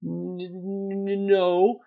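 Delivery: high, drawn-out stammer on the N; stuttering. A narrator's reading. Harry cornered by Snape's questions, stuttering. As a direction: stutter into a reluctant no. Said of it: "stuttered Harry".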